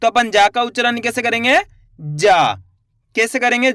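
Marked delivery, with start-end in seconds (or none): 2.0-2.5 जा said with force, long aa; emphatic demo. Shown as a demonstration. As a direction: lean hard on a drawn-out जा. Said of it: "उच्चारण में थोड़ा जोर लगा देंगे". (ज़ोर देकर लंबा जा)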